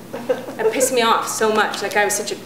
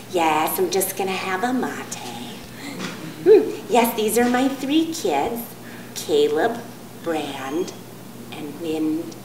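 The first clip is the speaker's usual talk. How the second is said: Marin hippie mom; 3 semitones lower, slower than usual. Retold or imitated. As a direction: float it out, smug and unhurried. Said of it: "they all order the same thing".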